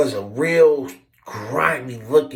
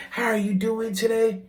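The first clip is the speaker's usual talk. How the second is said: mock sweet greeting; 7 semitones higher than usual. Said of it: "He said, "Don't try to play with me".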